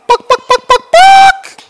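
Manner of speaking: chicken clucking. Pretend.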